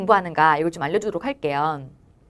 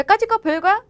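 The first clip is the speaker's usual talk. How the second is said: high, brash and dismissive, a bit louder; breezy confidence; 10 semitones higher, 4 dB louder than usual. Shown as a demonstration. Teacher modeling a carefree attitude toward studying, brash. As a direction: toss it off like nothing. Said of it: "라고 하면서".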